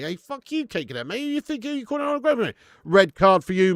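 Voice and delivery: playful fake anger, high and loud; mock outrage. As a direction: pretend to be offended, jokingly. Mock telling-off of a chatter.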